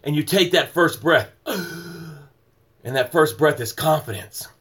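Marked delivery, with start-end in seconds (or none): 1.5-2.1 a quick, quiet drawn-in gasp for air; soft sharp inhale of a revived swimmer; acted gasp. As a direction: gasp like someone just pulled from the water. First breath after resuscitation.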